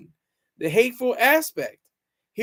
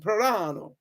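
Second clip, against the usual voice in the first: "Plorano" rolled out in a light mock Italian accent; 3 dB quieter than usual. Shown as a demonstration. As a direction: say "Plorano" like a proud Italian name, lightly hammed. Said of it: "I have to say it with an accent".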